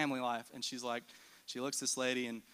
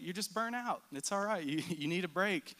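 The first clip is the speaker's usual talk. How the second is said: higher, tender reassurance, light laugh; gentle comfort; 6 semitones higher than usual. Retold or imitated. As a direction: soothe a burnt-out friend kindly. Mom comforting a tearful woman.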